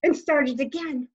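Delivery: plain reading voice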